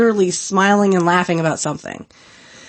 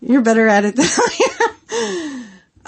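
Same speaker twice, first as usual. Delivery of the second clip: laughing; 5 semitones higher than usual. Retold or imitated. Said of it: "I'd be like".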